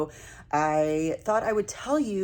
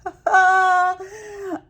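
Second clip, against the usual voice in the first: embarrassed high burst of laughter; 12 semitones higher, 7 dB louder than usual. Real, not acted. Realizes she met the designer.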